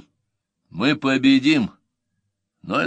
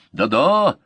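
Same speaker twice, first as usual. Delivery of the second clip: raised, louder hasty agreement; eager exclamation; 5 semitones higher, 6 dB louder than usual. A narrator's reading. Friend covering for the slinger before commanders, eager exclamation. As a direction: jump in to smooth things over. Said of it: "воскликнул Гет, желая сгладить неважное впечатление".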